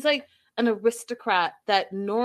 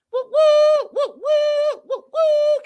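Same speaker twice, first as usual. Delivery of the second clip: high, loud woo-woos; repeated high cheering whoops; celebratory whooping; 8 dB louder, slower than usual. Real, not acted. Cheering congratulations to Gina.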